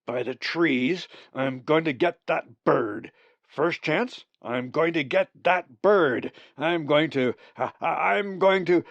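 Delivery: loud, high, furious sputtering rant; raging outburst. A narrator's reading. Raven Zack ranting about the bird who stole breakfast, furious and loud. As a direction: rage until the words trip over themselves. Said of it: "Zack returned to the ledge in a rage".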